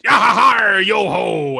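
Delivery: loud, high pirate holler; hammed-up pirate shout; pirate call. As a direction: bellow a hearty pirate yo-ho from a distant ship. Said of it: "that's what all pirates sound like".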